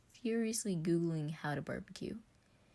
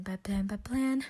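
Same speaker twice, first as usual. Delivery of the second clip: panicked; 4 semitones higher, 5 dB louder than usual. Acted.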